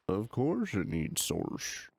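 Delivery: put-on slow drawled Southern country accent. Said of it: "I went into a country accent there".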